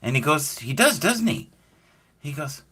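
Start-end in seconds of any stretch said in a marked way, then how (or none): 0.6-1.5 high-pitched imitated leading question; put-on high voice, baiting; coaxing agreement. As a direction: lift it high, leading them on. Imitates grandfather leading him on.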